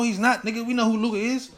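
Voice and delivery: ordinary talk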